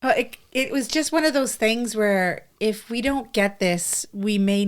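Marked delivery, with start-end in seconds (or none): none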